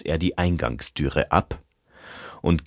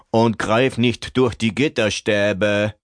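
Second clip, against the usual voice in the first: calling out; 6 semitones higher, 4 dB louder than usual. Acted. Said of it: "rief sie ihrem Nachwuchs noch zu".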